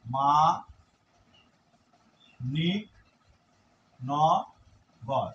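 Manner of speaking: মানিকনগর split into মা, নি, ন, গর with long pauses; syllable by syllable. Shown as a demonstration. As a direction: sound out মানিকনগর one syllable at a time. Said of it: "মানিকনগর শব্দটি আমি লিখছি". (মানিকনগর ভেঙে ভেঙে, মা নি ন গর)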